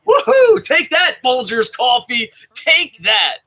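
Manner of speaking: high, loud whooping celebration; triumphant cheer. Acted. Host gloating at Folgers Coffee after a fan's praise, triumphant cheer. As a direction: crow over a beaten rival.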